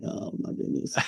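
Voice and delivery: soft breathy whisper of panic; whispered dread. Retold or imitated. Recruit reacting to the drill instructor, hushed whisper. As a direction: whisper it like you hope he can't hear you.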